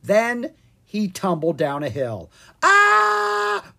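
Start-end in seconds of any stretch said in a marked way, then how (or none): 2.6-3.7 long, very high loud scream; tumbling-downhill shriek; falling scream. A narrator's reading. Tumbling down a hill.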